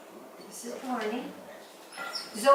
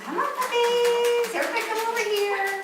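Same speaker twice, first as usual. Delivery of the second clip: puppy call; 9 semitones higher than usual. Real, not acted. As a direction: draw the puppies in with a long high call. Calling puppies again.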